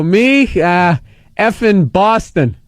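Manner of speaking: halting, drawn-out "effing Boston"; stalling drunk answer. Retold or imitated. Drunk driver telling the cop where he's from, stalling. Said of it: "you're cursed to, to give yourself time to collect your thoughts".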